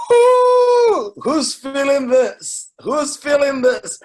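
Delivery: excited cheer. Real, not acted. Hyping livestream audience.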